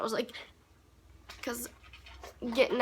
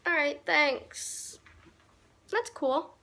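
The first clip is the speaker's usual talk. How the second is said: fake-casual thanks; 3 semitones higher, much slower than usual. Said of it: "so I'm like".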